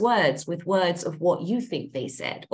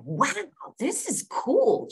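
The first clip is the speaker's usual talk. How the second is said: high, slow, wide-eyed alien wonder; curious alien amazement; 6 semitones higher, much slower than usual. Pretend. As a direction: marvel at it like you've never seen a human. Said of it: "So you're like".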